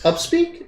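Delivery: "upspeak" rising high like a question. Shown as a demonstration. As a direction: lift the end of "upspeak" into a question. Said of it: "end everything as a question".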